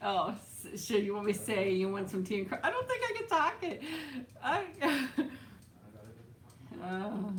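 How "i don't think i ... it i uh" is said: high, laughing through the words; breaking up laughing. Real, not acted. Streamer giving up on the British accent, breaking up laughing. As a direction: crack up and give up mid-attempt.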